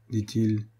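ordinary delivery

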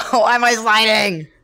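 high, loud cry of dismay; panicked shout. Real, not acted. Gamer losing control and sliding, panicked high shout. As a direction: shout it in alarm.

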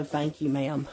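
ordinary talk

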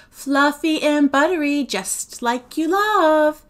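high, loud, sing-song temptation; tempting coax. A narrator's reading. Sophie tempts Agatha with biscuits, coaxing in sing-song. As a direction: tempt sweetly, pitched well up.

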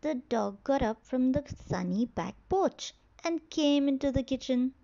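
ordinary delivery, nothing marked

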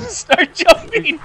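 slow, laughing through the words. Real, not acted. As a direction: giggle while repeating it.

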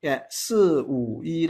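soft teacher model; middle stress, softening. Shown as a demonstration. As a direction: ease off after wu. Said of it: "three words emphasize the second word".

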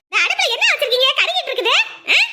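put-on high, loud, nagging older woman; nagging mother-in-law. Mother-in-law scolding daughter-in-law over the burning stove, high and loud. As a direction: scold her about the burning pot.

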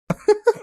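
short high-pitched laugh; gloating laugh. Acted. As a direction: laugh at someone's misfortune. Gloating over the trouble caused.

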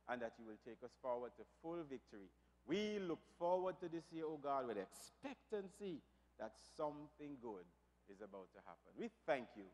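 ordinary talk, nothing marked

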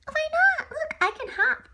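put-on high, whiny kid frog; little frog child. Son frog whines to Daddy in a high child voice. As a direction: pipe up like a small frog child.

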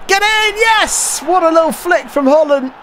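high, excited yell of joy; ecstatic goal celebration. Real, not acted. Gamer cheering his striker's goal, ecstatic high shout. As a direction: shout it out like your team just scored.

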